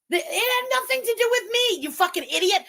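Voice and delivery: high-pitched, indignant outburst; exasperated rant. Real, not acted. Host telling off the woman in the clip, indignant. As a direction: set them straight, voice shooting up high.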